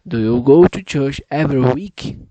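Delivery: loud, deliberate speech for the app; practicing a sentence for the pronunciation app, loud and deliberate; loud careful repeat. Shown as a demonstration. Saying it loud for the machine to catch. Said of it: "Ca-, capte melhor".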